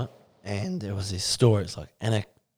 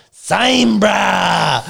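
1 shouted boast; 8 semitones higher, 6 dB louder than usual. Retold or imitated. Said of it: "And Shannon's like".

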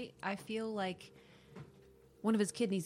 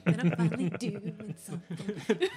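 slow, louder, laughing; excited laughing; 3 semitones higher, 7 dB louder, slower than usual. Real, not acted. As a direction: savor it with a delighted laugh.

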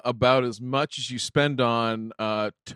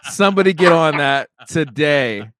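excited; 4 semitones higher, 8 dB louder than usual. Real, not acted. Excited about the joke.

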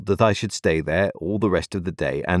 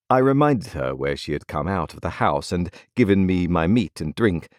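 usual speaking voice

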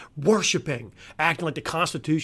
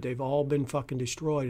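ordinary talk

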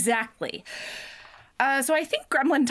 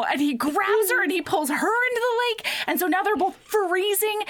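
normal talking voice

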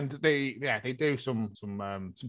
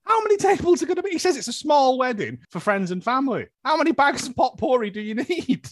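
incredulous; 8 semitones higher than usual. Real, not acted. Incredulous rant about potpourri.

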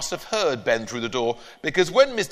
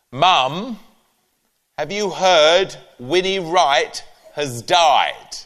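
slow, louder gentle news; imitating mum; 3 semitones higher, 5 dB louder, much slower than usual. Mum telling her mother someone died, imitated slow and louder. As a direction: break the news slowly.

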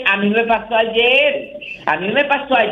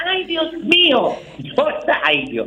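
a hammed-up Ay Dios mío, high and slow; mock drama; 7 semitones higher, slower than usual. Shown as a demonstration. Man shows the drama he makes over little discomforts, hammed-up and high. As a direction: show how he makes a drama over the smallest thing. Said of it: "ya yo hago un drama".

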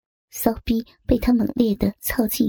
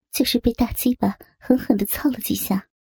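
plain reading voice

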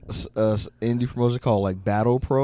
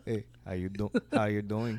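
soft, low mock Joey pickup line; smooth sitcom flirt; 3 semitones lower, 11 dB quieter than usual. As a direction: drop it quietly like a catchphrase come-on. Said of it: "He looked like Middle Eastern Joey".

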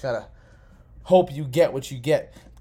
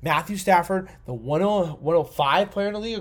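ordinary delivery

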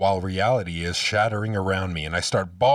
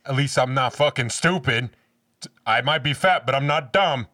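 loud, higher-pitched mock comeback; defiant retort; 6 semitones higher, 6 dB louder than usual. Fat kid bragging back at everyone, loud and defiant. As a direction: fire it back like a boastful, insulted kid. Acting out childhood comeback to bullies.

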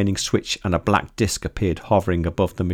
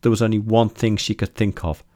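ordinary delivery

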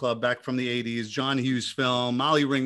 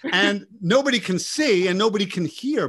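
normal talking voice

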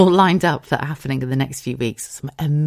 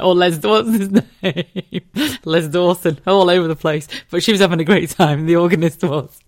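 louder, laughing through it; laughing while talking; 2 semitones higher, 5 dB louder than usual. Real, not acted. To listeners about the drunk organist, laughing. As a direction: giggle through the words.

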